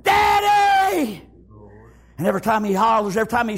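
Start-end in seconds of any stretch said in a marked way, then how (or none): 0.0-1.2 high, long, desperate shouts; screaming for parents. Acted. Child running through the house, screaming for parents. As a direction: scream each name high, drawn out and falling.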